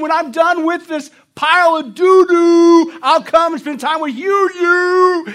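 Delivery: loud, high, slow dismissive shout; lazy backyard brush-off. Acted. Pastor plays the spouse yelling from the backyard, loud slow brush-off. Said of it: "you hear this voice from the backyard".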